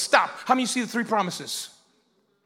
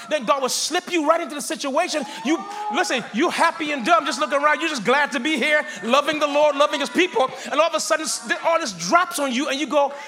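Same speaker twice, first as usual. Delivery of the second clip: rising, louder preacher's build; preaching; 4 semitones higher, 4 dB louder than usual. Acted. Preacher rousing the congregation, loud and high. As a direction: preach it hard to the church.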